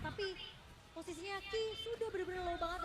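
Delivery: usual speaking voice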